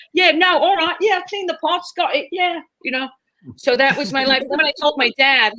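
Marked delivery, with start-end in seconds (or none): 0.1-2.6 higher put-on British accent. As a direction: switch into a chipper fake British accent. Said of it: "you're British, you're British".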